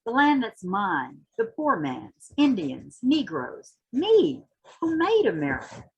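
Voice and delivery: rising, impassioned spoken-word reading; dramatic poetry reading with swelling intensity; poem recitation. Acted. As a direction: recite it like a rallying cry, building toward the end. Recites Hughes poem after introduction.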